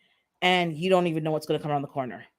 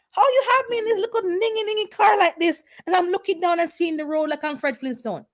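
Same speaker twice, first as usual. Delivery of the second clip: much higher, mock outraged whine; incredulous protest; 12 semitones higher than usual. To the driver about the bottomless car, high mock outrage. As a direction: squeal at him in playful outrage. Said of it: "he's like".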